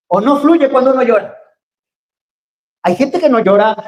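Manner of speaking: high, loud, fervent sermon voice; impassioned preaching. Acted. Preacher urging the church to cry and let it flow, passionately.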